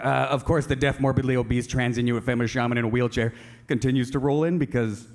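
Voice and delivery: ordinary delivery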